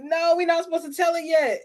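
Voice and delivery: lightly acted, high-pitched objection; mock protest. Imitating Tito as news slips out too early, mock high protest. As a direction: pitch it up like someone stopping a leak.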